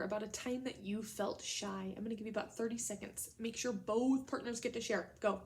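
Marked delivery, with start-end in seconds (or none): none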